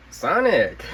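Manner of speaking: high, bright announcer call; high excited shout-out; excited intro. Real, not acted. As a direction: present it with a flourish.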